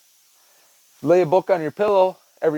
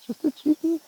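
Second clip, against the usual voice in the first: much higher, singsong pet voice; 14 semitones higher than usual. Real, not acted. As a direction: coo it to the scared cat. Repeating to cat, high voice.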